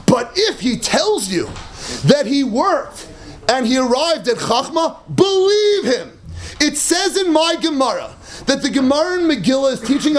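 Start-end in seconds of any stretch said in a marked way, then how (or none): 5.1-6.2 emphatic. Acted. Punchline of the Gemara reading.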